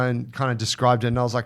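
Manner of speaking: ordinary talk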